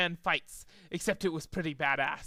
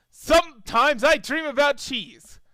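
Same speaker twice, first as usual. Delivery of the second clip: high, loud, slow dreamy blurt; odd interrupter; 7 semitones higher, 8 dB louder than usual. A narrator's reading. A pony in the audience interrupting, high, slow and loud. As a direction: blurt it out of nowhere.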